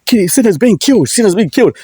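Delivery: put-on high excited old man calling; grandfather voiced. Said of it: "my grandfather like".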